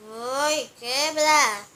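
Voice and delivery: loud frustrated shout. Real, not acted. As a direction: yell it out at the screen. Shouting at the game.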